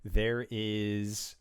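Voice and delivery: slow, dramatic scene-setting; game master narration. A narrator's reading. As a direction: paint the monster slowly and ominously. GM narrates the creature's appearance.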